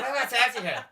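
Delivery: exaggerated Northern Irish local; Belfast accent. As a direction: repeat it in a thick Belfast lilt. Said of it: "He said".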